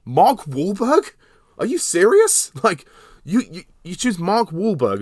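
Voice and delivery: can't believe this casting; squeaky outraged disbelief; incredulous. Real, not acted. Mocking the casting choice.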